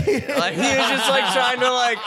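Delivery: high, loud laughing through the words; cracking up mid-sentence. Real, not acted. Laughing while retelling friend's climbdown.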